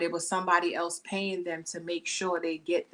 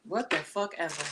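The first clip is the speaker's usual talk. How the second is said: dismissive brush-off; 3 semitones lower than usual. Retold or imitated. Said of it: "I'm like".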